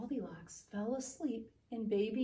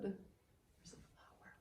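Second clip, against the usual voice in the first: hushed, higher discovery; surprised whisper; 13 dB quieter, slower than usual. A narrator's reading. Narrator whispers to the children that there's a flower. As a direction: whisper it as if spotting a clue.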